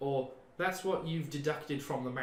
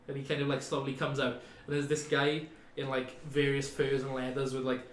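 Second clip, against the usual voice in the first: usual speaking voice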